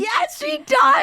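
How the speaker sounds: high, loud delighted agreement; excited. Real, not acted. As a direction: burst out in eager agreement. Agreeing excitedly about mom.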